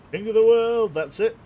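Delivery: Jack Sparrow style. Pretend. Captain posing at the bow, hammed-up triumphant shout. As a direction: belt it from the bow like a movie hero. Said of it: "Jack Sparrow style".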